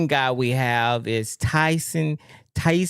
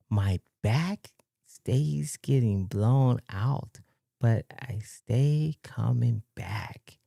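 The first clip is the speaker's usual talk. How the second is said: very slow and soft, phrase by phrase; slow, hushed, baffled read-aloud; puzzled slow reading; 4 semitones lower, 7 dB quieter, much slower than usual. Real, not acted. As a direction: read it out haltingly, trying to make sense of it. Rereads baffling message word by word.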